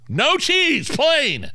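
high, loud exasperated snap; frustrated customer. Retold or imitated. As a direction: insist firmly, fed up. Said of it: "That would get a little frustrating".

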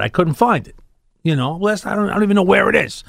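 usual speaking voice

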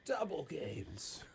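very soft, drawn-out echo; hushed mock awe. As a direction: echo it slowly under your breath. Hushed echo of other speaker's phrase.

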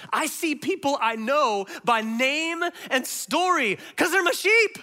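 normal talking voice

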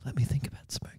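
hushed whisper during a silence; soft, unvoiced plea for quiet; whispered request. Real, not acted.

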